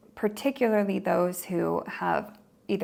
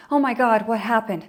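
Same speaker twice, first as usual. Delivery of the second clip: startled cry; 2 semitones higher, 6 dB louder than usual. Retold or imitated. Hypervigilant person startled by dropped cup, mock startled cry. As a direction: imitate someone jumping out of a chair.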